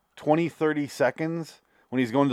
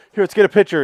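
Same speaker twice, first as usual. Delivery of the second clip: excited; 7 semitones higher, 7 dB louder, faster than usual. Retold or imitated. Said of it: "he's like".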